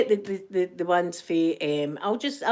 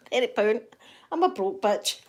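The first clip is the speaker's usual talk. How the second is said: ordinary delivery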